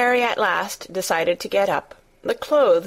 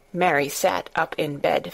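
usual speaking voice

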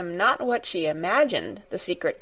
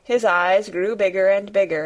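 usual speaking voice

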